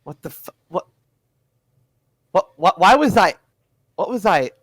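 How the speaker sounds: confused outburst. Acted. Dazed prisoner wakes, confused.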